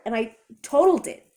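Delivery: plain reading voice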